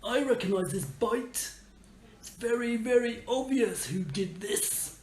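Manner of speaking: a grumpy tree spirit just woken up; sleepy tree voice. Acted. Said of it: "looks at this nut and goes".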